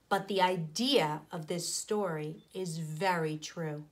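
plain reading voice

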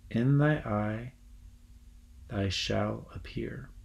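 slow, measured reading of Shakespeare's verse. Acted. Teacher reading Oberon's spell to the class, slow and measured. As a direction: lay out each line of the spell slowly and evenly.

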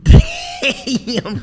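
high, bursting laugh at the punchline; burst of laughter. Real, not acted. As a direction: let a big laugh break out. Host laughs at closing line.

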